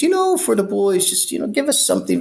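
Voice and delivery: high, coaxing cop voice asking for money; hint at a bribe; sly cop. Retold or imitated. Cop asking the inmate to give something, high sly voice.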